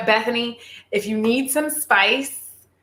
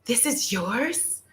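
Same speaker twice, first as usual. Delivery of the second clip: surprised question; 7 dB quieter, much slower than usual. Acted. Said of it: "I'm a good actress".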